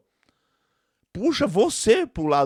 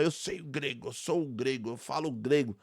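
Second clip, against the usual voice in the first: mock boast; 6 dB quieter than usual. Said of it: "alguém na internet falar".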